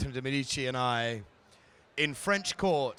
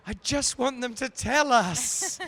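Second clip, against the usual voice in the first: high, slow, pleading quote of a fellow actor; imitating Megan Follows; 8 semitones higher, 4 dB louder, slower than usual. Retelling her coffee-morning plea in a higher, slowed voice. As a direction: mimic her wanting the news, pitched up and drawn out. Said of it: "and she went".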